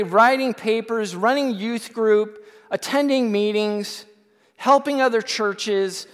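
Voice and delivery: loud, high, indignant complaint to God; angry rant at God. Retold or imitated. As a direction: shout the list of burdens, fed up and proud. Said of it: "I'm ticked off at God".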